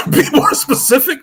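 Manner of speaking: high laughing complaint; laughing plea. Retold or imitated. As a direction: squeal it out, half laughing. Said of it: "I'm like".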